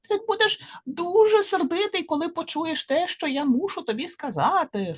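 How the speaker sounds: high-pitched little fly. A narrator's reading. Fly warns Gila of news, in a high little voice. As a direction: lift it high for a tiny creature. Fly's speech voiced in translation.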